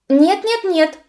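high, loud protest; exclaiming. A narrator's reading. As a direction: cry out to stop him. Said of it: "воскликнула Мика". (возмущённое восклицание)

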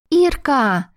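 high, bright-eyed shout of a name; excited cry. A narrator's reading. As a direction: leap up and call her name. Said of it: "вскинулась огненная". (радостный высокий возглас)

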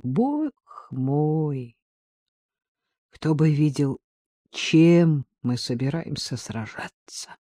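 understated, rueful exclamation with drawn-out words; a touch of forced amusement, no real laugh; strained amusement. A narrator's reading. Artyom surveys their meagre arsenal, with strained amusement. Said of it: "натянуто рассмеялся".

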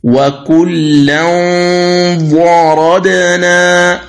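وكلا ضربنا recited slowly, the tanween a heavy nasal hum before ضربنا; slow recitation of وكلا ضربنا; heavy nasal ghunna. Shown as a demonstration. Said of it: "التنوين".

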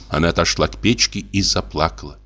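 usual speaking voice

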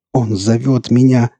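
high, drawn-out rapture; entranced, fervent cry; passionate babble. A narrator's reading. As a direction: utter it with a zealot's passion. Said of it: "страстно пролепетал эльф".

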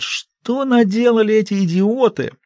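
high, slow groaning complaint. A narrator's reading. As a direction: moan the question out. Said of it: "Большой Джим стонет".

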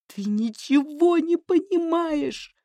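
high, slow desperate cry; shouting. Acted. Anya shouts at her mother in despair. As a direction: cry out that she is misunderstood. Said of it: "закричала Аня".

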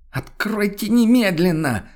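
high, slow commanding shriek; a stranger demanding entry through the door; demanding shout. Acted. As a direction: pound the words out in fury. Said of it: "потребовал голос снова".

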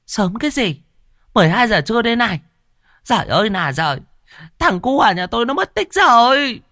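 a frantic mother bursting in; panicked fat woman. Acted. Hoa panicking about missing son. (mụ Hoa the thé, hốt hoảng báo tin con mất tích)

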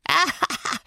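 high, soft acted cackle; croaking laughter. A narrator's reading. Old servant laughs at the raging boy, croaking. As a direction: cackle croakily in malicious glee. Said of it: "sein krächzendes Gelächter".

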